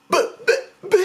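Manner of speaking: high, broken stammer of disbelief; sputter, unable to find words; speechless. Retold or imitated. Speechless at being abandoned.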